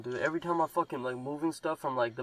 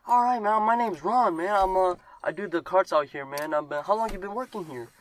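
higher, louder hammed-up old cart guy; imitating Ron the cart guy; 6 semitones higher, 4 dB louder than usual. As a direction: do the chatty old cart worker voice. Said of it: "He's like".

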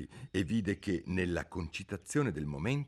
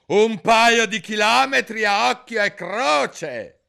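much higher, loud, slow; queen exaggerating Alice's height; queen's voice; 14 dB louder, slower than usual. A narrator's reading. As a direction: pile on with scorn. Queen adds.

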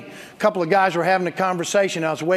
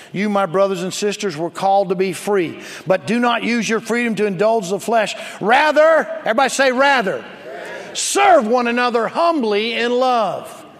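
loud, raised-pitch scripture reading, driving to a forceful finish; preaching scripture; 4 semitones higher, 5 dB louder than usual. Acted. Pastor reading Galatians to the congregation, forceful preaching. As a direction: proclaim the verse with mounting force.

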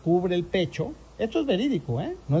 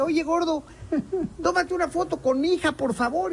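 high, sweet-talking mom voice; pleading mother; 8 semitones higher than usual. Retold or imitated. Mother asking the host for a photo, high and coaxing. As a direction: coax him into a photo. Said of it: "Llega una señora con su niña y dice".

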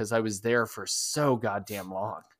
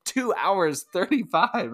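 higher-pitched, incredulous echo of the time; retold amazement; 7 semitones higher, slower than usual. Runner marvelling at the winner's finishing time, incredulous. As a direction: repeat it as if you can't believe it. Repeats record time in disbelief.

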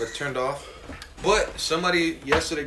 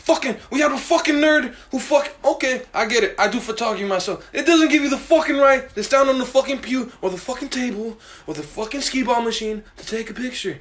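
loud, higher, swearing in anger; heated rant; 7 semitones higher, 5 dB louder than usual. Real, not acted. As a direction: vent loudly about a rude stranger. Heated rant about bar patron.

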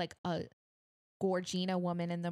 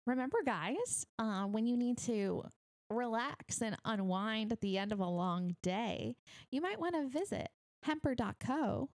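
high, bright sponsor pitch; ad read; 4 semitones higher than usual. Acted. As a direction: sell it like a radio ad. Reads a sponsor ad.

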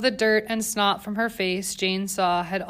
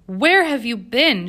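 slow, much louder demand; 3 semitones higher, 7 dB louder, slower than usual. A narrator's reading. Governess confronting Jane in the foyer, loud slow demand. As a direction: make her answer for it. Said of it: "she demanded".

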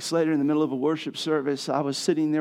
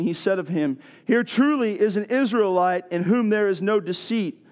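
raised, expressive reading of the passage; scripture reading; 3 semitones higher than usual. Acted. Pastor reading the Gospel to the congregation, raised and expressive. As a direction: read the Bible story out to the church.

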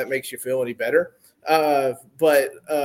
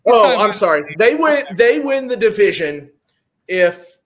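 loud exasperated; 5 semitones higher, 8 dB louder than usual. Real, not acted. Mock apology, loud.

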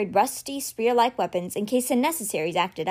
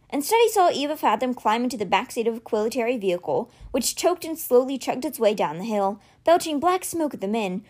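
usual speaking voice

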